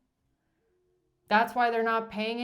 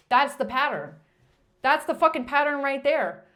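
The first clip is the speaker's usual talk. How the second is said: exasperated outburst; 3 semitones higher, 6 dB louder than usual. Real, not acted. Exasperated ranting reader.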